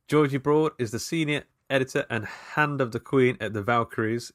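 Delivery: normal talking voice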